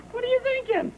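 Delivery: put-on high, disbelieving squawk of an old colonel; incredulous Bulger. Old colonel squawks at the officer ordering a charge, incredulous. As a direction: throw it back at him, outraged.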